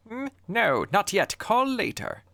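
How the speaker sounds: very high put-on character voice; phone stranger. Mystery voice on the line turns caller away, high put-on voice.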